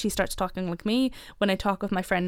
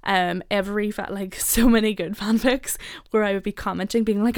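usual speaking voice

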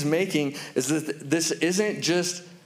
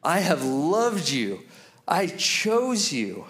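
very slow, deliberate sincerity; solemn assurance; much slower than usual. Retold or imitated. God speaking over us this morning, solemn and sincere. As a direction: let each word land tenderly. Preacher voicing God's declaration.

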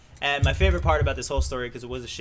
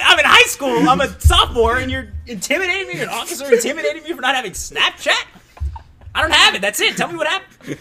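higher, louder, laughing indignation; laughing retold protest to the officer; indignant protest; 10 semitones higher, 9 dB louder than usual. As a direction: protest like a wronged teenager. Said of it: "I'm like".

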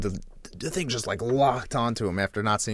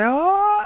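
long, high-pitched swooning "oh"; high mock-emotional "oh"; 4 dB louder than usual. Viewer about to tear up, mock-emotional wail. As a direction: imitate welling up with a drawn-out cry. Mimics viewer before tears come.

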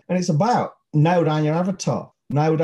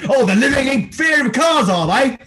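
mocking high sneer, louder; sarcastic mock; 9 semitones higher, 5 dB louder than usual. Host mocking poster people, scornful high sneer. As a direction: sneer it like a taunt.

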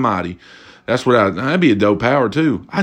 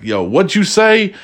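higher, slow taunting "Yo"; mock street challenge; 7 semitones higher than usual. Imitating a guy squaring up on the street, slow and higher. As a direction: dare him to say it again. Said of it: "some motherfucker come across".

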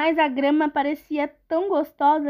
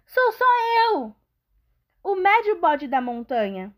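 high, timid goat voice; middle goat voice; 5 semitones higher than usual. Acted. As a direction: answer meekly like a goat. Middle goat answers the troll.

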